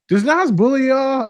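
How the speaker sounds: high, slow, loud teasing question; client's question. Retold or imitated. Girl in group therapy asks about [name], high teasing voice. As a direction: ask it high and drawn out, teasing. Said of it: "the one girl goes".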